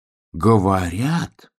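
ordinary delivery

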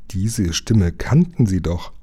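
ordinary delivery, nothing marked

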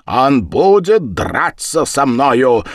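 slow, loud, high declaration; forceful and unyielding; firm insistence. A narrator's reading. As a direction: refuse to budge, stretching the words. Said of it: "твёрдо стоял на своём Ворона".

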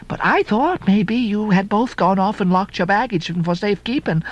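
high, fretful maid's chatter; chambermaid voice. Acted. Chambermaid telling Ishmael about the silent room, high fretful voice. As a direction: babble it like a worried servant girl. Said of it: "she cried".

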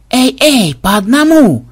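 high loud protest; yelling. Acted. Xenobytes yelling at the two Makmeds, loud and high. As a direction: shout them down. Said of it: "завопили ксенобайты".

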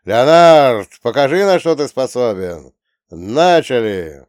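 loud, slow, high announcer call; arena announcer. Acted. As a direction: present the fighter to a roaring crowd. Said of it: "надрывающегося ринг-анонсера".

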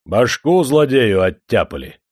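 loud, rough-and-ready announcement; booming courier. A narrator's reading. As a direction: thunder out the news like a courier. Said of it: "загрохотал басом курьер". (громовое известие курьера)

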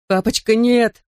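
high-pitched, urgent plea; pleading child. A narrator's reading. Son begs his father not to hit mother, high plea. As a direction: beg him to stop, pitched high. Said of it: "взмолился Бен".

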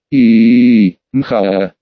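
long, loud moan dropping low; acted moan with a low tail. A narrator's reading. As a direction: hold a loud moan, then let it fall. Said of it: "заставило её простонать".

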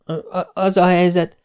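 high mock stammer; gúnyos dadogás. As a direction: mimic his stammer mockingly. Mother mimics the stammering son. (csúfolódó, magas dadogás)

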